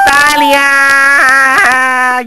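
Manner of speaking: very drawn-out loud shout for claps; calling for applause. Acted. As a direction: hold the word long and loud.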